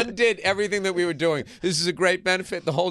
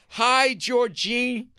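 slow, higher, sure-of-himself hello; confident host greeting; 8 semitones higher, 3 dB louder, much slower than usual. Retold or imitated. Host leading the crowd, in a slow confident greeting. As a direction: stretch out the hi like a showman. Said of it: "He goes like this".

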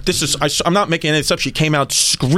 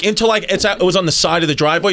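ordinary delivery

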